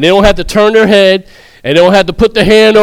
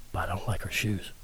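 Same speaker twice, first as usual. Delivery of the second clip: hushed, near whisper; whispered catty remark; lowered voice; 14 semitones lower, 23 dB quieter than usual. Shown as a demonstration. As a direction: drop it low like a secret. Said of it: "why I gotta lo".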